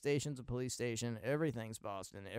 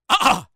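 warm-up vocal yelp; 23 dB louder than usual. Shown as a demonstration. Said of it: "I'll do a Kathleen Turner impersonation".

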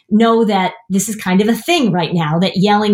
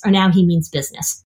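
ordinary delivery

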